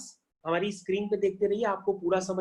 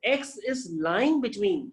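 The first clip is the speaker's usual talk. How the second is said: very slow, stretched model reading; teacher modelling phrasing for students; much slower than usual. Shown as a demonstration. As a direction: say it slowly so students copy. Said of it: "आप बोलेंगे".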